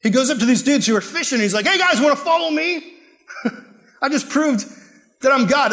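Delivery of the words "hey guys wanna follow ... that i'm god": high, chummy call with a laugh; breezy invite to new friends; casual Jesus. Acted. Jesus calling fishermen from their nets, high and chummy.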